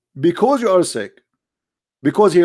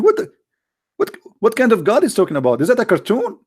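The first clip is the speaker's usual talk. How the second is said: incredulous; 7 semitones higher, faster than usual. Real, not acted. Incredulous ridicule.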